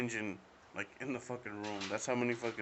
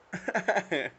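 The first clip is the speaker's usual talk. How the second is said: real laugh; 8 semitones higher, 7 dB louder than usual. Real, not acted. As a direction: burst of real laughter, louder and higher. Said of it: "Okay, I made myself laugh".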